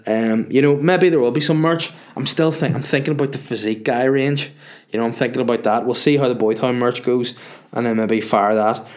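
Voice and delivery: ordinary delivery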